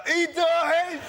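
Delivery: put-on high, loud drunken yell of a passer-by; drunken shout. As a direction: bellow the spoiler like a drunk stranger. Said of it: "a minute later".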